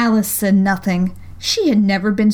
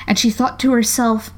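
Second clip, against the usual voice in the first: plain reading voice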